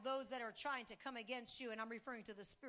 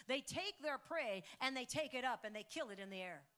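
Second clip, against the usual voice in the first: loud, high, fast rallying call; preaching climax; 4 semitones higher, 6 dB louder than usual. Acted. Pastor calling the church to declare victory, loud fervent. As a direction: rouse the crowd to declare victory.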